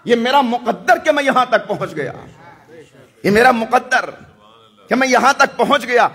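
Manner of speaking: preaching at full force. Real, not acted. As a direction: cry out the devotion of arriving at the shrine. Preacher rising to a climax.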